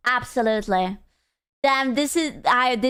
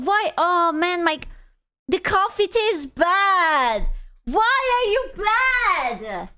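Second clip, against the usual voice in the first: whiny complaint; 6 semitones higher, slower than usual. Real, not acted. Scolding the bad coffee.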